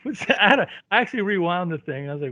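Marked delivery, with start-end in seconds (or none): 0.0-0.4 high, quieter incredulous squeak; baffled double take. Retold or imitated. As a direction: act out a puzzled double take. Acting out his baffled double take.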